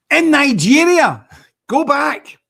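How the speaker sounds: loud, high heckling yell; shouted retort. Real, not acted. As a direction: shout it back at the man on screen, drawn out. Heckling reply to the played clip.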